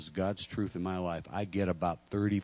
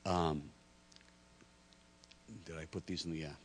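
ordinary talk, nothing marked

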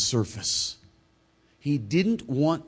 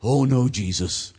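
slow, louder friendly protest; chummy protest; 5 dB louder than usual. Acted. Peter's words to Jesus, chummy and friendly. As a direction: object like a concerned pal, drawn out. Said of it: "as chummy and as friendly".

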